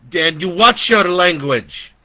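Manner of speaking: put-on high, loud scolding old lady; scolding grandmother. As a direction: Nana scolds Dan for his language, high and loud.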